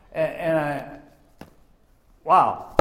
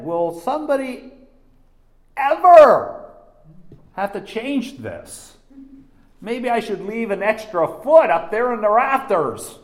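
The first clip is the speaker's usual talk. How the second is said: higher and louder, "ever" stretched up; mock thoughtful installer; 7 semitones higher, 8 dB louder than usual. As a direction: play the careful planner he wished for. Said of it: "if you would have thought ahead".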